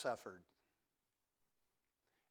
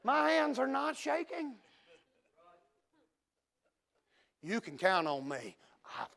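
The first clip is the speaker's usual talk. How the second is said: steady, unshakeable hero; 10 semitones higher, 4 dB louder than usual. Pretend. Preacher acting as the calm rescuer his listeners can count on, ringing confidence. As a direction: play the calm rescuer who won't flinch. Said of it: "Do you see this look in my metaphorical eyes?".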